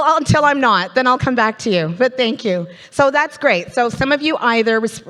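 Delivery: ordinary talk, nothing marked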